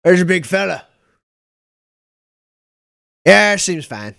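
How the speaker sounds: a hint of a gruff cook calling back. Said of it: "he goes".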